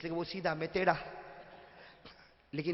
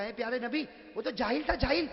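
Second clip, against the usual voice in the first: rising higher and louder in disbelief; astonished protest; 8 semitones higher, 8 dB louder than usual. Retold or imitated. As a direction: build from wonder to an amazed outcry. (حیرت سے بلند ہوتی ہوئی آواز)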